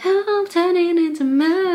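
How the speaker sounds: high-pitched mock mermaid wail; mocking high-voiced mermaid; ditzy TV mermaid. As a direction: play the mermaid's big transformation up high. Imitates mermaids transforming.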